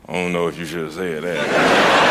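very low voice of the whiskey, second thoughts; Jack Daniels. Acted. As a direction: caution him in a deep undertone. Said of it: "that's when that Jack Daniels said".

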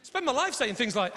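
ordinary talk, nothing marked

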